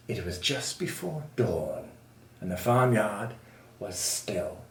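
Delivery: low, soft storytelling; hushed narration. A narrator's reading. Narrating a still, stealthy night scene.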